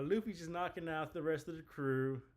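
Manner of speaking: ordinary talk, nothing marked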